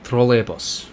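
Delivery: "trolleybus" as troh-ley-boos; Russian trolleybus. Shown as a demonstration. Echoing a viewer's correction, Russian troh-ley-boos. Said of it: "Semi Marco saying it too".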